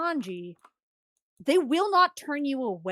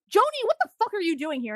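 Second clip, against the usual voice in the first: high, fast, incredulous question; acted outburst at an unexpected friend; startled demand; 7 semitones higher, faster than usual. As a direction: blurt it out in mock disbelief. Zoro startled to see old friend.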